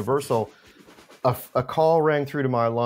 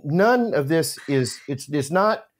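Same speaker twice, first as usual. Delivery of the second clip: normal talking voice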